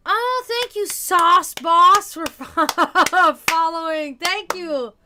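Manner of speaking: loud, high, delighted thanks with a laugh; excited thanks. Real, not acted. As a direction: gush your thanks to the new follower. Streamer thanks new follower loudly.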